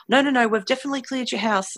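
mimicked team, high and fast; insistent reassurance. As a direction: brush off the worry in a quick, high voice.

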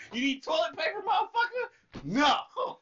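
high, stretched out taunt; mocking high taunt. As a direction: sneer it up high, slowly. Taunting as China.

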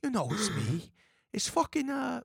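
high-pitched pleading wise guy; Bobby Bacala. Shown as a demonstration. Host imitating a lovable Sopranos character, high and pleading. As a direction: put on a high, wheedling mobster voice. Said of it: "he just has, like, this".

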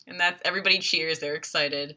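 ordinary talk, nothing marked

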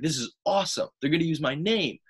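high-pitched retold excitement; fired up. Wrestler hearing his own name will be used, fired up. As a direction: relive being thrilled, pitch jumping up. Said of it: "I got fired up".

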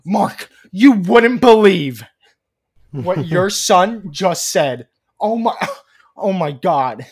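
loud, high, slow hammed-up outrage; outraged mother. Imagining mother telling her partner about son, hammed-up outrage. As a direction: wail it slowly in mock horror. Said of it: "was like".

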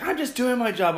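high, loud imitation of a protest; high-pitched retold protest; protesting employee. Employee protesting to angry customer.

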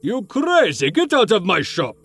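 put-on high, furious old shopkeeper yelling; angry old shopkeeper. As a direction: throw him out of the shop. Shopkeeper throws customer out.

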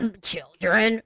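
put-on slow, disgusted drawl on children; mock disgust. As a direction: say it like the word makes you gag. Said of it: "every time she tried to say children, she wanted to vomit".

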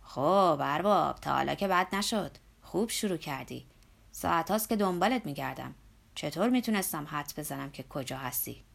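put-on hearty loud call from the old workman. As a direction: call out to the boss loudly, from a distance. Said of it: "با صدای بلند گفت".

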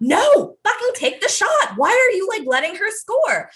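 imitating yelling mom. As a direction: bark it like a pushy sideline mom. Mom yelling at daughter to shoot.

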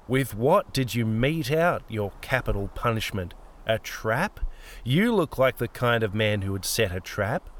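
lightly voiced old woman, quietly interrogating; sardonic old woman. Old woman asks Peter how he killed him, sardonic.

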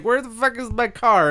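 put-on high confused drunk voice; lightly voiced lost drunk; mock drunk. Bartender imitating the drunk hunting for his car. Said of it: "He's like".

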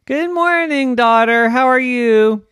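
high, loud sing-song greeting; cheery greeting mother. Acted. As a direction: sing out a morning hello to your child. Mother greets her daughter.

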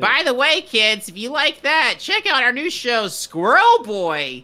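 high, peppy channel promo voice; kids' TV host. Pretend. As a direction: sell it to kids like a promo.

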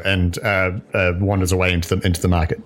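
ordinary talk, nothing marked

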